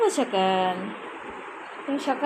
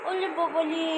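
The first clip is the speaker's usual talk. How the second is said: put-on cooing baby talk; 3 semitones higher, 4 dB quieter than usual. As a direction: coo like talking to a baby. Mother coaxes sulking toddler.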